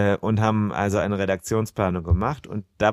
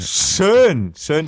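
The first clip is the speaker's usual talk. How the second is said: excited; 7 semitones higher, 6 dB louder than usual. Real, not acted. Bursts out after interruption.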